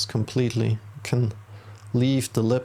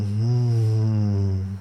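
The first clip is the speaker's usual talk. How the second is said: sustained, steady closed-mouth hum; long exhaling hum. Shown as a demonstration. Meditation teacher models the exhale for the listener, long steady hum. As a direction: hum the breath all the way out.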